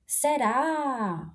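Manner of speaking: "Será" with its stressed ending drawn out long; stretched last syllable. Shown as a demonstration. Teacher showing where the accent falls, stretched last syllable. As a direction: hold the final "rá" of "Será". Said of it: "eu puxo mais aqui a última sílaba".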